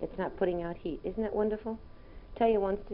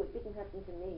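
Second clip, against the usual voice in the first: ordinary talk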